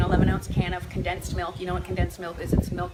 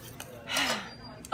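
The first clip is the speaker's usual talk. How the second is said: soft sigh; 8 dB quieter than usual. Real, not acted. Sighs at pregnancy challenges.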